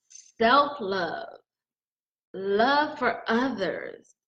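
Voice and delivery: ordinary talk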